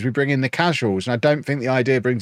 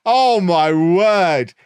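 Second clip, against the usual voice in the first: very slow, loud, higher groan of disbelief; exasperated; 7 semitones higher, 5 dB louder, much slower than usual. Real, not acted. Reacting to a rival's smug line, slow loud disbelief. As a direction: stretch it out in pure disbelief.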